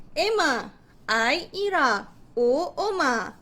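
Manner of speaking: slow, drawn-out letter then name; storytelling name list. Shown as a demonstration. Teacher naming the vowel siblings for children, slow storytelling. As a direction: introduce each one separately for children. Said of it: "sebut nama mereka".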